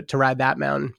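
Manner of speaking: normal talking voice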